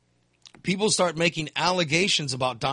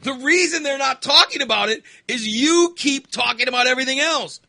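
higher, louder exasperation; exasperated; 8 semitones higher, 6 dB louder than usual. Real, not acted. Mocking Trump to his cohost, exasperated. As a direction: scold him in disbelief.

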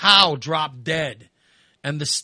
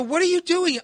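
very high, exasperated demand; exasperated question; 12 semitones higher than usual. Retold or imitated. Son confronting mother in the hallway, high exasperated. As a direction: throw up your hands verbally.

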